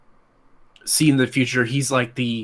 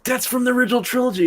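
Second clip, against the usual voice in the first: high, fast, excited blurt; excited exclaim; 12 semitones higher, faster than usual. Retold or imitated. Fan telling his dad in the theater, fast excited blurt. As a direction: rattle it off high and thrilled. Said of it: "I'm like".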